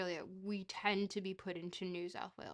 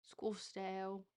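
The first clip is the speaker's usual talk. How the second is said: slow, softer stab at Australian accent; attempted Australian accent; 5 dB quieter, slower than usual. Shown as a demonstration. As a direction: practise an Australian accent, drawing it out. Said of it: "I'm losing my Australian accent".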